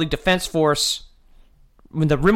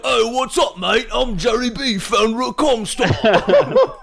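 higher hammed-up Australian drawl, laughing at the end; Australian accent; 6 semitones higher, slower than usual. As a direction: play a cartoonish Aussie introducing himself. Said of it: "That's Australian, I'm pretty sure".

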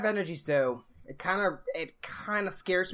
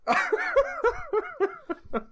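words coming out of a real laughing fit; laughing hard; 3 dB louder, faster than usual. Real, not acted. Co-host to Gator after his combo, laughing hard. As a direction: groan it out while still cracking up. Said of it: "I'm laughing so hard".

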